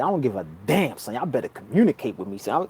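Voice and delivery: low, quiet and a little quick, daring the spirits; low quiet vow. Retold or imitated. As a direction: murmur a challenge to the unseen. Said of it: "I said".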